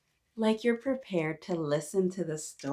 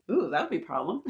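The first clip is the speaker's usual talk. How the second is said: chuckling through the words; laughing; 7 semitones higher, much faster than usual. Real, not acted. Host amused by the idea with kids, laughing. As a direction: let a laugh spill in.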